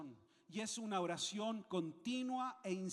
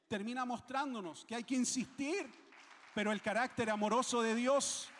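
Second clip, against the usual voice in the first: loud, insistent exhortation; preaching; 2 semitones higher, 5 dB louder than usual. Real, not acted. Preacher urging the congregation to pray with tenacity, forceful preaching. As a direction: drive it home forcefully to the congregation.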